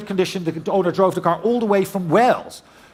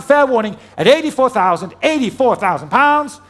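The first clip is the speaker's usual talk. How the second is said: loud final call; 4 semitones higher, 5 dB louder than usual. Real, not acted. Final call before the gavel.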